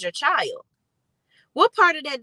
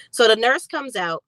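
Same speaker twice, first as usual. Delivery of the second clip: ordinary talk, nothing marked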